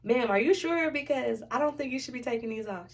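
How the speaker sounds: politely alarmed protest, starting loud; worried patient protesting. Retold or imitated. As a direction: question the nurse with mock alarm.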